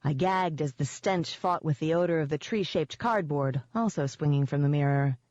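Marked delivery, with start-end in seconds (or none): none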